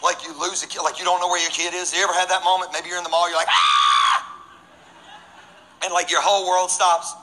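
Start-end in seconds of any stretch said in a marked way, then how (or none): 3.5-4.4 long loud "Ah!" of alarm; sudden drawn-out scream; panicked yell. Acted. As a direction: yell out as if your child vanished. Parent losing child in mall.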